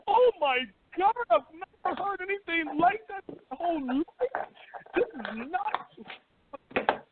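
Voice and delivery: high, hammed-up gasp of shock; a mock scandalized cry; mock outrage. Mocking shocked critics of Trump.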